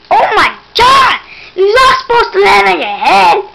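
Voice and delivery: loud, high, scolding yell; real frustrated outburst; exasperated shout. Real, not acted. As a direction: shout at the player in disbelief. Player lands on head again.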